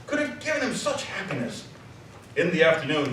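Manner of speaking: ordinary talk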